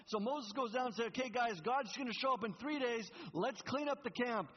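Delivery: high, loud, animated sermon storytelling; fired-up preacher retelling Moses; preaching at full force. Acted. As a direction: tell the story big to the whole church.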